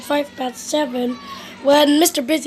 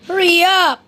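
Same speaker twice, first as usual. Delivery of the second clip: loud, higher Hurry up; impatient call; 5 semitones higher, 5 dB louder than usual. Acted. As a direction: shout him along. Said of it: "he cried".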